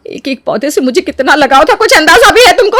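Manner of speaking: anguished reproach building high and loud; hurt anger. Acted. Hurt outburst over lost plants. (आहत गुस्सा, चढ़ती आवाज़)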